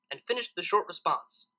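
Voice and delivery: plain reading voice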